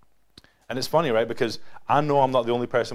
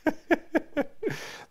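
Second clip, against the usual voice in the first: a quick laugh up high; high breathy laugh. Real, not acted. Laughing after reading the verse to the group. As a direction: let a high laugh slip out.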